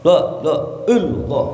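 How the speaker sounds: choppy, emphatic chant syllables; wrong-way dhikr demo. Shown as a demonstration. Preacher showing listeners how not to chant dhikr, choppy and emphatic. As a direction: punch out the syllables in bursts. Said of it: "nggak gitu, nggak".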